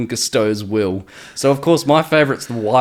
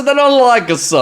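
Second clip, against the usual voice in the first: put-on much higher, louder New York accent; exaggerated New York accent; 11 semitones higher, 5 dB louder than usual. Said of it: "I said".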